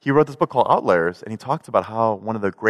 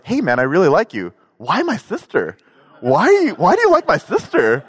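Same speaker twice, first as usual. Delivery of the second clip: much higher, louder mock-baffled brother; brother quoted; 8 semitones higher, 4 dB louder than usual. Brother vetting him, high, loud and incredulous. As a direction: ask it like he can't believe it, laughing. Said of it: "his vetting was".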